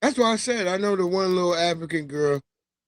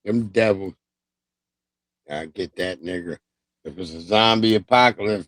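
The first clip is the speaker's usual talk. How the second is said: ordinary delivery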